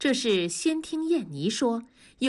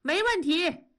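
excited reply; 8 semitones higher than usual. A narrator's reading. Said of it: "兴奋地答应着".

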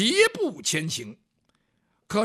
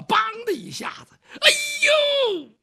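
pained cry; 8 dB louder than usual. Acted. Fist hits the pole, pain.

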